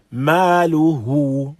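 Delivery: slow ما له with the ه of له stretched two counts; two-count elongation. Shown as a demonstration. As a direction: draw out the ه in ما له for two beats. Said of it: "We have some elongation upon it".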